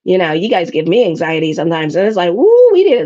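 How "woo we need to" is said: high, loud "Woo" exclamation; much higher, louder whoa; burst of alarm. Real, not acted. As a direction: blurt it out to snap them out of it. Urging listeners to stop.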